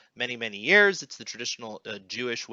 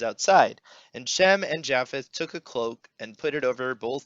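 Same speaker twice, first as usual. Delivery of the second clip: measured, louder solemn Bible reading; earnest scripture reading with deliberate pacing on the names; 5 dB louder than usual. Acted. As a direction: read the passage like a lector at the pulpit. Reads scripture aloud.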